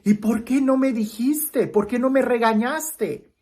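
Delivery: put-on a boy asking why he wasn't scolded; pleading boy. Boy pleading with his grandmother, high and slow.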